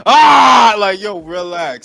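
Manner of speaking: loud cry, then a push to relax; exasperated yell. Real, not acted. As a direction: shout down an over-the-top celebration. Mocking the celebration of an easy win.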